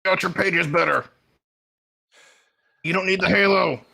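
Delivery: loud, high, exasperated mock yell; hammed-up exasperated holler; mock scolding yell. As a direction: shout it as if shaking him by the shoulders. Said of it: "grab him, shake him, be like".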